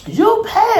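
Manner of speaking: very high mocking landlord voice; shrill landlord. Landlord demanding rent, high and shrill. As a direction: squeal the demand. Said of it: "She's screaming".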